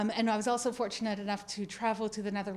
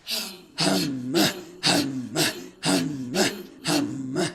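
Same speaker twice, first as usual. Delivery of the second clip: deep growling throat hum; low, slow monster hum; 8 semitones lower, 4 dB louder, much slower than usual. Shown as a demonstration. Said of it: "and it should sound like this".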